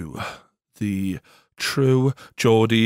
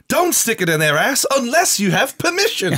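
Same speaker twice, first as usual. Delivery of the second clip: put-on children's show voice; 12 semitones higher than usual. Parodied children's show advice.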